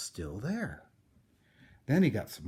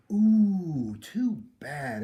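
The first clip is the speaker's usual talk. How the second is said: a high, loud ooh of pity, then too bad; neighbours' mock sympathy; sympathetic ooh; 7 semitones higher, 9 dB louder, much slower than usual. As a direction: groan it out for the neighbours. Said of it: "everybody said".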